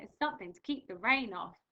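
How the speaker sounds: lively storybook read-aloud; reading to children. Acted. Teacher reading a picture book to her class, lively.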